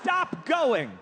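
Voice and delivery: a sudden comic yell; mock shout. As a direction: blurt it out like an alarm. Joke punchline outburst.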